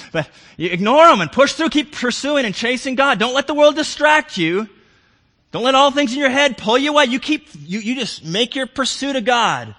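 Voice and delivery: loud, high, driving sermon climax; preaching at full force. Acted. Preacher urging his congregation to seek God, full-voiced. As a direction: preach it with rising fervor.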